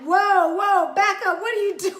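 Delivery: loud startled whoa, backing off; mock shout. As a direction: shout him off in mock alarm. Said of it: "and he was like".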